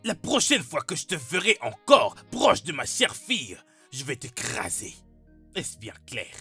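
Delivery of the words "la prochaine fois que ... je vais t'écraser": loud, high-pitched warning; angry threat. Acted. Father warning a boy off his daughter, angry threat.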